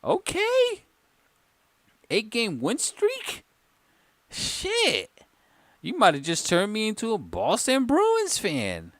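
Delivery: very high, incredulous reaction; soaring, impressed astonishment; amazed disbelief. Real, not acted. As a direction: pitch it way up, newly won over. Amazed by the win streak.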